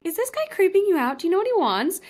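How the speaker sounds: whisper-urgent warning to a fellow stranger; warning a stranger about a creepy man, alarmed; worried urgent question. Retold or imitated.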